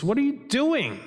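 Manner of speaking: mildly indignant demand; lightly acted indignation at the intruders. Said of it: "at first he was indignant".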